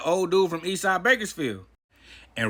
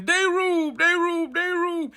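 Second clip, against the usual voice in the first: yelling; 11 semitones higher, slower than usual. Retold or imitated. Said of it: "I hear the Hispanics yelling".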